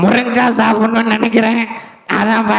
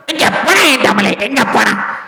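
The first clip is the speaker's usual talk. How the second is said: thunderous political oratory; 4 semitones higher than usual. Acted. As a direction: rouse the crowd like a politician. Mimicked leader addressing Tamil people. (முழங்கும் அரசியல் மேடைப் பேச்சு)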